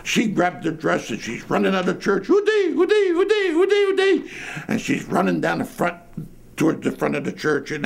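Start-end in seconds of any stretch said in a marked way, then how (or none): 2.3-4.3 high, rapid comic hoots of fright; imitated panicked wailing. Storyteller mimicking an old lady running out of church, panic-stricken hooting. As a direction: hoot it high over and over like someone fleeing. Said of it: "panic-stricken".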